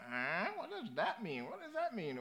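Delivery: high, baffled mock American accent; hammed-up American voice, confused by British words. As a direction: pitch up high as a puzzled American. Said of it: "It's my best American accent".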